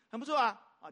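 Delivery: ordinary delivery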